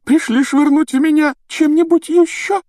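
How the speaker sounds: very high, loud aggrieved whine; hysterical wailing. A narrator's reading. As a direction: wail accusingly in a put-on high voice. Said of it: "рыдала громко, надрывно, как никогда".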